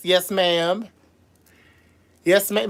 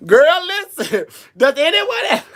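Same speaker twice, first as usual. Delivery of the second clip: sassy call out; 10 semitones higher, 8 dB louder than usual. Real, not acted. Drag host sassily calling for questions. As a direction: demand attention with sass.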